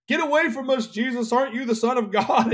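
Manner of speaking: high, agitated imitation of the possessed; demon-possessed outcry. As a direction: cry out like a demon confronting Jesus. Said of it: "demon possessed would just say the craziest".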